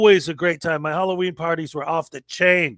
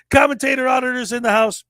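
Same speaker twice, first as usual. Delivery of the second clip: hype announcer; 6 semitones higher than usual. Acted. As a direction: announce the show like a hype man to the crowd. Shouts the guest intro again.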